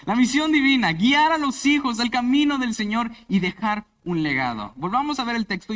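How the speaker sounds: loud, high fervent sermon voice; full-force preaching. Acted. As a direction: proclaim the mission to the whole church.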